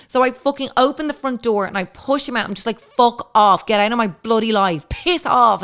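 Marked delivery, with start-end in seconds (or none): 2.8-5.6 louder, higher angry brush-off; mock-furious get out; angry shooing. Pushing the French man out the door, angry and loud.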